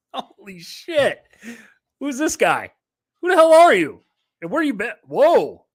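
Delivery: hammed-up who-is-this-guy greeting, loud and high; mock surprise. Host greeting his late co-host, playful mock surprised shout.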